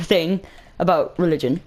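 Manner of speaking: ordinary delivery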